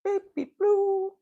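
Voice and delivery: very high, slow sing-song name; calling the cat sweetly, very high, slow. Real, not acted. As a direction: coo the cat's name up high.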